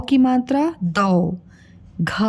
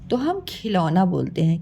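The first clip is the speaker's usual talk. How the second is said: normal talking voice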